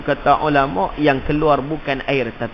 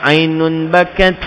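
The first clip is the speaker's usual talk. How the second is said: slow Arabic recitation; 5 dB louder, slower than usual. Acted. Hadith recited.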